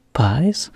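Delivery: Pies rising sharply; teacher echoing a word back, puzzled rise; echo question. Shown as a demonstration. Echo question, Pies rising high. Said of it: "all you're saying is, What is a pie".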